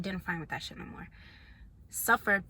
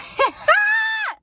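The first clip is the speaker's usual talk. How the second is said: high, loud cackle; loud burst of laughter; 13 dB louder than usual. Real, not acted. Laughing at her own confession to viewers, a loud cackle. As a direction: let a big laugh explode out.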